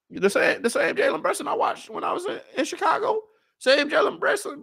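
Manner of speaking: fanboy squeal of amazement, pitch climbing; gushing fan. Real, not acted. Fan calling out to Jalen Brunson in giddy squealing disbelief.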